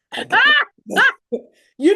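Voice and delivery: high, loud laugh breaking through the words; laughing loudly; laughing burst. Real, not acted.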